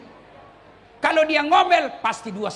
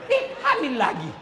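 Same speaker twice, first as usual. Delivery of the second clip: mock disgusted husband; 10 semitones higher than usual. Mocking annoyed husband, high.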